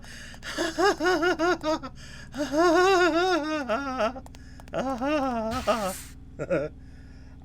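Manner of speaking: long, high fit of real laughter; high relieved cackling; rattled laugh. Real, not acted. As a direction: laugh it out helplessly in high bursts. Laughing after a game scare.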